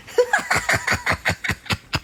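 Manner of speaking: very high-pitched giggle; real high, rattling laugh; gleeful cackle. Real, not acted. Trickster amused at fooling the ex-husband, gleeful cackle.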